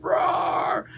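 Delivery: imitated monster roar; drawn-out, rough mock beast roar; demon dog roar. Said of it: "two-headed demon dog".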